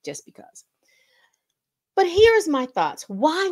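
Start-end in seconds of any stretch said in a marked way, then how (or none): 0.1-0.6 whisper. Real, not acted. Trailing off after the slide.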